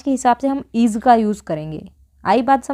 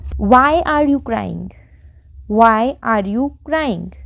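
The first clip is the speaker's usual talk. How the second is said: very slow, every word apart; slow model sentence; much slower than usual. Shown as a demonstration. Teacher showing the English sentence to learners, very slow. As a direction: stretch it out word by word. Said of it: "तो हमारा sentence क्या बना".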